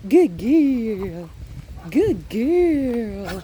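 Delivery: loud, drawn-out praise; dog praise. Real, not acted. Trainer rewards the dog, loud stretched-out praise.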